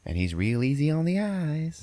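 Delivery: high put-on lady voice; shop lady. Parlor lady recommends a guy named Ben, high mock-flirty voice. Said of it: "the lady who runs it".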